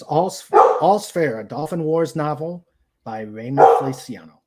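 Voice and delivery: ordinary talk, nothing marked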